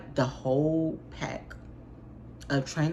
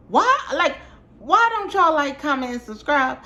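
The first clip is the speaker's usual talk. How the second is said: mock exasperation; 10 semitones higher, 5 dB louder than usual. Real, not acted. Mock exasperated plea to viewers.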